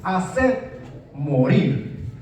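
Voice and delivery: hacer morir drawn out slowly, each word weighed; slow stressed phrase. Shown as a demonstration. Preacher to congregation, hacer morir slow and weighty. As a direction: let hacer morir sink in, slow and low. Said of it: "empieza así esta expresión".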